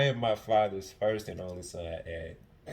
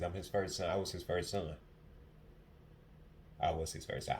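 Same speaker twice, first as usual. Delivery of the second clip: ordinary talk, nothing marked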